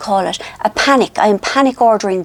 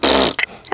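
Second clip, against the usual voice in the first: short lip-buzzing pfft; answering raspberry. Retold or imitated. Aunt answering her baby niece, short blown raspberry. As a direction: blow the raspberry straight back. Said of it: "look at her and I go".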